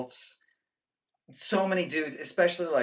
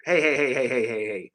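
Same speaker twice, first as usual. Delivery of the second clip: loud calming heys; 8 dB louder than usual. Real, not acted. Calming down excited chat.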